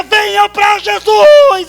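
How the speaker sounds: high, loud and slow shouted call; street-preacher shout. Retold or imitated. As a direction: call out to the crowd from the truck. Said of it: "Eu começava a gritar". (pregador gritando do caminhão para a multidão)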